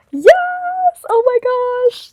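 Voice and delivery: high, loud delighted squeal; excited squeal. Real, not acted. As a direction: shriek with real joy at the gift. Unboxing glasses, thrilled reaction.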